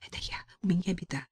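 urgent frightened whisper. Acted. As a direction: whisper fast, as if someone might hear. Frightened call for help.

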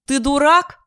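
high, loud furious yell; yelling like a madwoman. A narrator's reading. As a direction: scream it at him. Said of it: "орёт, как безумная".

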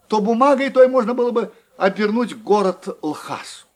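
plain reading voice

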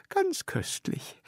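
very high and slow, savouring; old lady relishing a sweet. A narrator's reading. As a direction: draw it out in delight. Frau Peters savouring chocolate.

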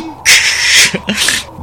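breathy sinister cackle; cold laugh. Acted. Mother cackling coldly at her bound daughter. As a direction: chill them with a laugh.

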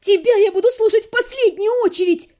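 high, loud hysterical yell; girl screams for the king amid the chaos; shouting demand. A narrator's reading. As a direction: demand the king at the top of her voice. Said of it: "перебила я его".

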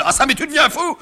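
high, loud indignant father; alarmed outraged shout. Acted. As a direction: burst in yelling, aghast. Father bursts in on mock murder.

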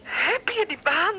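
eager question. Acted. Wife eager about the job.

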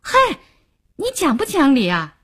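angry yell, not full force; indignant shout. Acted. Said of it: "他吼了一声".

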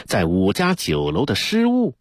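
usual speaking voice

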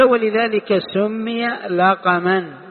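slow, deliberate reading of the quote; reading a source slowly to students; slow quoted reading. Shown as a demonstration. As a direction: read the text out slowly, word by word. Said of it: "قال".

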